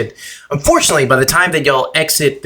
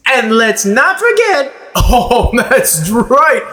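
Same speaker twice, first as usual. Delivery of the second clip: showwoman; 10 semitones higher, slower than usual. A narrator's reading. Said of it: "the lady speaks".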